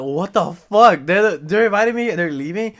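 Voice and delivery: hammed-up shock at being left behind; retold disbelief. Drunk guy finding his friends abandoning him, shocked and loud.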